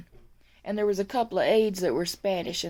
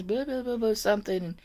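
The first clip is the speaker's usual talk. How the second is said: ordinary delivery, nothing marked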